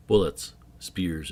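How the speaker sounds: usual speaking voice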